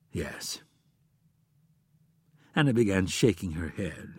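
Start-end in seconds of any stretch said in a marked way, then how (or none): 0.1-0.6 very soft, low admission; hesitant. A narrator's reading. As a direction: reluctantly admit it, barely voiced. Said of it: "After hesitating for a second, he said".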